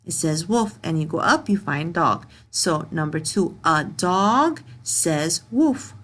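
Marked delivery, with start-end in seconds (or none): 3.6-5.9 slow reading. Shown as a demonstration. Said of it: "teacher reads answer slowly".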